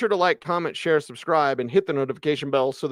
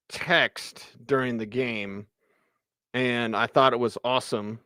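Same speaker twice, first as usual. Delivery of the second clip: usual speaking voice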